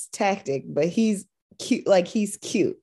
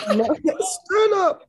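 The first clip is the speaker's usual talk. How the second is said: laughing; 11 semitones higher, slower than usual. Real, not acted. Echoing the yell, laughing.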